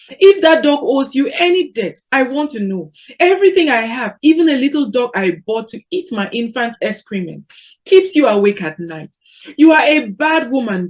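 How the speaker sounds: high, loud, relentless tirade; a jealous wife screaming insults; furious shouting. A narrator's reading. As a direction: keep hammering her rival with blame. Udenko keeps shouting at Mboye.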